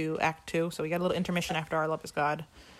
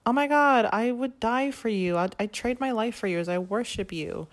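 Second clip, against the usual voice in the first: gushing devotion; 4 semitones higher than usual. Retold or imitated. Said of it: "And J D's like".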